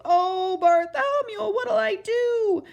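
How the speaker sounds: high, loud pleading wail; desperate wail. A narrator's reading. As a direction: beg for rescue, stretched out. Stuck trumpeter begging help.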